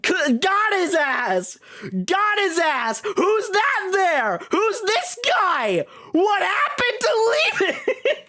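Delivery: high-pitched hollering, words stretched out; hammed-up, high yelling disbelief; mock outraged shout. Streamer exposing the liar who said he was leaving, mock outrage. Mock outburst at being lied to.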